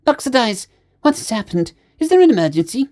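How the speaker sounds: high-pitched alarmed space otter. A narrator's reading. Space otter finding his choking crewmate at the door, alarmed. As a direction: question him in high alarm. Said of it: "opened the door in alarm".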